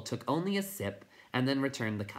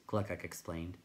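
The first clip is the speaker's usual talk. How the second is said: usual speaking voice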